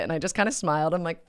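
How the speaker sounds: normal talking voice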